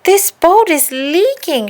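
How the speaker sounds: high, loud, slow cry of alarm; alarmed shout. A narrator's reading. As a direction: call out as the boat fills. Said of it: "Susie shouted".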